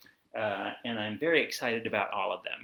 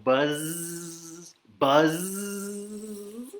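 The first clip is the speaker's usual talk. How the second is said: long buzzing zzz, rising; bee buzz; 5 semitones higher than usual. Acted. Reader asks kids to go buzz, droning bee. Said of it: "He can sound like a bee".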